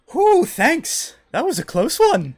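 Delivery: very high, loud character voice; high-pitched, relieved and chirpy; relieved wizard woman. Acted. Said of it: "She goes".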